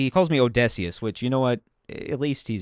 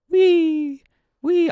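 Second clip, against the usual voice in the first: high, loud gleeful whee; excited whee; 7 dB louder than usual. Real, not acted. Player gliding over ocean, squealing whee. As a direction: squeal with joy while gliding.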